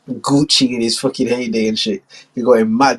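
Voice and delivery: ordinary talk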